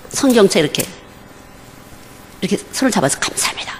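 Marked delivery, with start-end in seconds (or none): 3.2-3.7 whispered thanks. Shown as a demonstration. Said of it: "이렇게 했어요".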